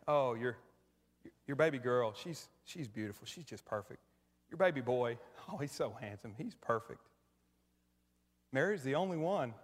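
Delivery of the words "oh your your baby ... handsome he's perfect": soft gushing coo over a newborn; cooing over a baby. Retold or imitated. Imitating moms praising each other's babies, soft cooing. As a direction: hush it like praising a sleeping baby.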